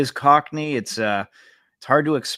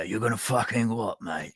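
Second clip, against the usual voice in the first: mock Cockney challenge, trailing softer; Butcher; 4 dB quieter than usual. Co-host imitating Butcher, mock Cockney challenge. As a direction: challenge him in put-on Cockney. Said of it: "He uses a Cockney accent".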